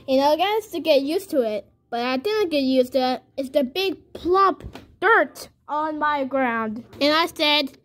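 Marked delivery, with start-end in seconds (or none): none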